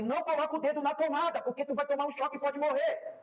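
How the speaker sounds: high, urgent parental warning; alarmed warning. Acted. Parent warning a child about the socket, alarmed warning.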